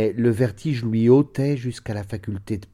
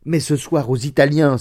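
loud, higher-pitched objection; sudden exclamation; 5 semitones higher, 8 dB louder than usual. A narrator's reading. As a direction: burst out with the realisation. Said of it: "s'écria-t-il". (exclamation soudaine, forte et aiguë)

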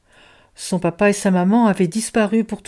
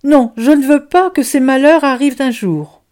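loud, higher outcry of refusal; passionate cry; 6 semitones higher, 6 dB louder than usual. Acted. Boustru refusing the children's future suffering, loud and high. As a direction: plead against fate, raised. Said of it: "s'écria Boustru".

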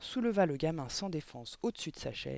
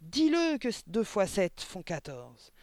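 raised, furious threatening harangue; thundering; 5 semitones higher, 4 dB louder than usual. A narrator's reading. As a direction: bellow threats at the pupil. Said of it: "tonna de nouveau mademoiselle Le Gourdin". (menaces tonnantes)

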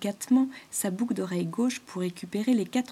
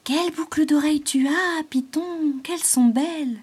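high, bright-eyed gushing over earrings; admiring exclamation; 8 semitones higher, 6 dB louder than usual. Acted. Coquettish insect Rosie admires the python's earrings, high and gushing. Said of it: "s'exclama Rosie".

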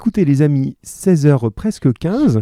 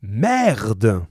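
réplique de Cambronne. A narrator's reading. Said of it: "Cambronne répondit".

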